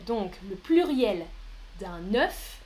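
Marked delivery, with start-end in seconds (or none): none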